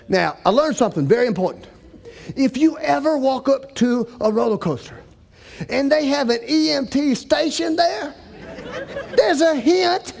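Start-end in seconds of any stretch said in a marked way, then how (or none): none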